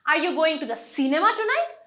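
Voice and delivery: "cinema" punched, question rising at the end; stress on CINEMA. Shown as a demonstration. Teacher asks if it is the cinema, stress on "cinema". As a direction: hit "cinema", as in there and nowhere else. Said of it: "তুমি সিনেমাতেই যাচ্ছ".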